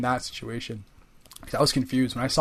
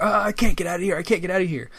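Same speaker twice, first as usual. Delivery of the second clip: mock panic; 5 semitones higher, 3 dB louder than usual. Mock panic of stuck player.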